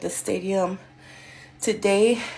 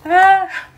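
excited high yelp; 9 semitones higher, 7 dB louder than usual. Real, not acted. Remembering brush full of hair.

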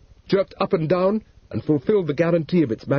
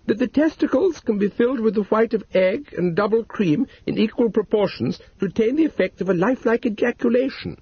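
higher, primly read instructions; reading aloud; 9 semitones higher than usual. A narrator's reading. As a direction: spinster reading the leaflet primly. Maud reads instructions aloud.

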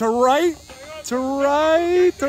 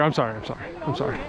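much softer, lower, half-laughing sorry; soft apology; 10 dB quieter, much faster than usual. Real, not acted. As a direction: apologise under your breath. Apologising to teammate after shaking him.

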